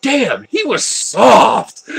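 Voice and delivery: slow, musing disbelief; a touch of slow wonder; amazed inner thought. Retold or imitated. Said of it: "I'm thinking".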